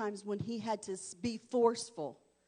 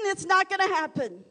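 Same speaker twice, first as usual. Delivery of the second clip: louder, higher fiery sermon; preaching at full force; 8 semitones higher, 11 dB louder than usual. Acted. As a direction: drive it home to the congregation.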